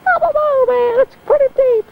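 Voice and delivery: very high, squeaky voiced cat; a tiny cat complaining in a very high voice; cat's voice. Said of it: "Cat's like".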